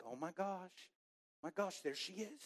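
soft, awed dread; hushed acted alarm. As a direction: say it under your breath as she nears. Child sees woman approach the row.

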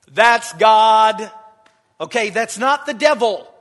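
shouted, ringing proclamation; loud preacher's declaration. Acted. Preacher declaring to the church that it is God, loud. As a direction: bang the point home.

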